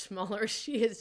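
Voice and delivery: usual speaking voice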